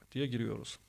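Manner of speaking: ordinary talk, nothing marked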